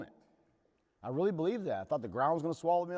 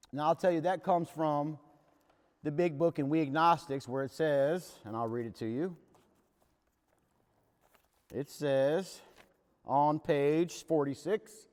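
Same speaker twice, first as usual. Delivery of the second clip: ordinary talk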